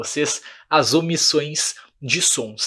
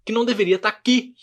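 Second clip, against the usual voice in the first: baffled student; 6 semitones higher than usual. Retold or imitated. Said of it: "as pessoas ficam meio bugadas".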